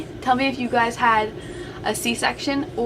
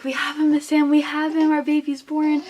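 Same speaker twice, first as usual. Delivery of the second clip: higher, louder happy announcement; retold nurses calling out with joy, higher and louder; excited staff; 6 semitones higher, 3 dB louder than usual. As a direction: cheer the news to the new mom.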